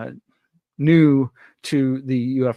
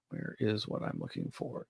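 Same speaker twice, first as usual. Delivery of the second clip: much softer, absent-minded search talk; muttering to self; 3 semitones lower, 15 dB quieter than usual. Real, not acted. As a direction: mumble it under your breath while searching. Searching for a link on air.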